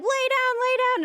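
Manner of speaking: high, loud urgent yell; mock frantic shout. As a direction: shout the order at someone on the ground. Staff yelling at her.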